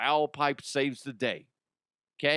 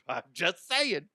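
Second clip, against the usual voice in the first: usual speaking voice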